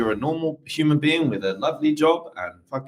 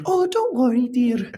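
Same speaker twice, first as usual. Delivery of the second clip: Mrs Doubtfire voice; 13 semitones higher, slower than usual. Retold or imitated. Comforting the co-host as Mrs Doubtfire, high falsetto. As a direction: coo like a Scottish nanny. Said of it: "Missus Doubtfire".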